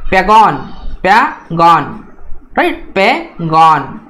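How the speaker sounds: पैगॉन with the stress on गॉन, slow; teacher modelling पैगॉन stress; stress on second syllable. Shown as a demonstration. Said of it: "इस type से उसको pronounce करना है".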